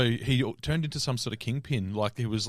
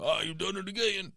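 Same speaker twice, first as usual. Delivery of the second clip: mock wobbly protest; 8 semitones higher, 5 dB quieter than usual. Imitating drugged, wobbly character.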